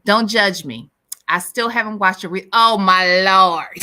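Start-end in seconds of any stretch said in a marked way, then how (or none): 2.5-3.8 long, loud, drawn-out cry of disbelief; loud, stretched-out outburst; loud exclamation. Real, not acted. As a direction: exclaim it slowly at full volume. Mock-scandalised reaction to listener's comment.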